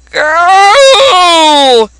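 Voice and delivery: put-on high shouted call of Girl; shouted cry. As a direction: yell it out as a sharp cry. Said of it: "That was a different voice from the first one".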